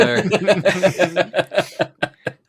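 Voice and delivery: high, loud burst of laughter; long helpless high laugh; big laugh. Real, not acted. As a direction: crack up at your own joke. Cracks up after own impression.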